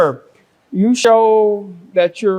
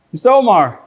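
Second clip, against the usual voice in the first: loud, raised-pitch summons; calling out; 4 semitones higher, 8 dB louder than usual. Real, not acted. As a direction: call the man up sharply. Judge calls on the next party.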